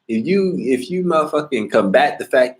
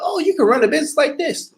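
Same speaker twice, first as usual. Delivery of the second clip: mocking quote.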